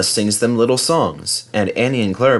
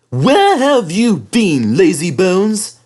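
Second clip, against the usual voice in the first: higher, louder, slow cross scolding; crossly; 9 semitones higher, 5 dB louder, much slower than usual. Acted. Thomas scolds Henry for being late, slow cross call. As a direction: scold the late engine slowly. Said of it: "asked Thomas crossly".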